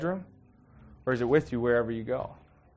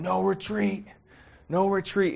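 mock yell; 7 semitones higher, slower than usual. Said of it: "Mel Gibson starts yelling".

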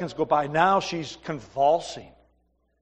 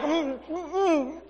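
mouth-shut mock hum, higher and softer; tight-lipped humming; 9 semitones higher, 5 dB quieter, much slower than usual. Husband at airport humming to show he won't talk. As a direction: hum through sealed lips to keep a vow. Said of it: "I'm like".

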